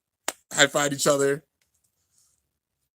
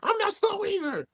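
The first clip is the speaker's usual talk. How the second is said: put-on indignant kid retort. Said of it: "he's like".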